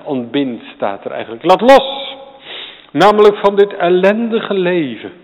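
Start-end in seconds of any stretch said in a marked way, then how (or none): 1.4-5.1 preached outcry. Real, not acted. Preaching at full force.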